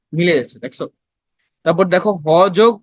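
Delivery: ordinary talk, nothing marked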